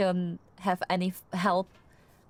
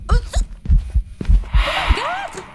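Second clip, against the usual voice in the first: loud, high shriek of fright; real scream; 11 dB louder than usual. Real, not acted. Terrified player shrieking at the ghost, screaming.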